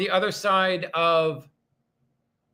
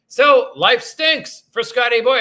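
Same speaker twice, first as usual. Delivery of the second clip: mock lament; 5 semitones higher, 7 dB louder, slower than usual. Real, not acted. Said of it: "self-mocking lament".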